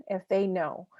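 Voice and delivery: usual speaking voice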